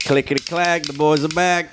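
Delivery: put-on playful Southern accent opener; mock southern accent. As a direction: announce the return like a Southern showman. Show intro in southern accent.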